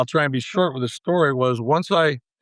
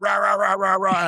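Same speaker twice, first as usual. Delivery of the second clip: higher-pitched garbled scolding; mock tirade; 5 semitones higher than usual. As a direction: imitate an angry boss ranting in rah-rahs. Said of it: "And he lit into me".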